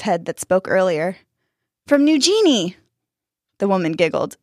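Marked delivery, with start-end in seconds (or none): 1.9-2.8 put-on higher, lilting child's voice; eager child. A narrator's reading. Danny to the fisherwoman's head, eager. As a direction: pipe up like a curious kid.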